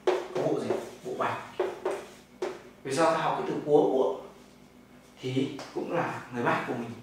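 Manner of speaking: usual speaking voice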